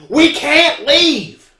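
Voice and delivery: high, loud, drawn-out yell; panicked woman yelling across a store. Retold or imitated. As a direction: wail it out loud and slow, full voice. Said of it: "a woman comes in yelling".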